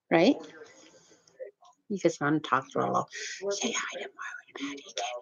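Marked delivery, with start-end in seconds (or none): 3.5-5.2 breathy whisper, much softer; retelling a friend's whispered message. Retold or imitated. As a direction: pass on the secret message in a hush. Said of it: "like this, eh?".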